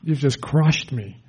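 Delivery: low, soft, deflated; crushed. Retold or imitated. Preacher voicing someone drained by complaints, deflated. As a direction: sound flattened by someone's troubles.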